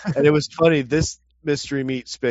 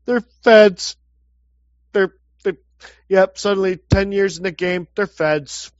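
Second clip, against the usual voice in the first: loud, raised exasperated blurt; exasperated outburst; 7 semitones higher, 8 dB louder than usual. Real, not acted. Ranting to the co-host about being called feds, loud exasperated. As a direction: spit out the accusation in disbelief.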